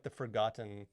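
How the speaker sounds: normal talking voice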